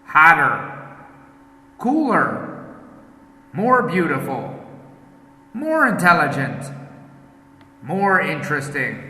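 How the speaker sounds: each word alone, with a pause for the class to repeat; repeat-after-me model. Shown as a demonstration. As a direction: clear teacher's drill words, one at a time. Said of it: "I say, you repeat".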